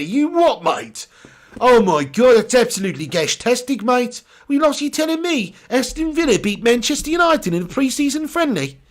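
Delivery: hammed-up, high-pitched Brummie; badly faked Brummie outrage; bad Brummie accent. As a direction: put on a terrible mock Birmingham accent. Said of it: "that's the worst Brummie accent I've ever done".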